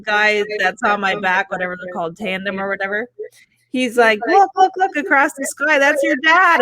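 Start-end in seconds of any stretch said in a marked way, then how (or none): 4.3-6.6 higher-pitched, eager retold call; excited pointing out. Tandem instructor showing her dad across the sky, excited. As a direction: imitate an instructor urgently pointing something out.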